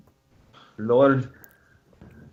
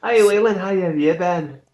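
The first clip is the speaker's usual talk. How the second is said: put-on Scottish accent; 4 semitones higher than usual. Knight greeting Waylon in a hearty Scottish accent. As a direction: call out to an old friend in a Scottish lilt. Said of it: "whatever you just said accent just broke me".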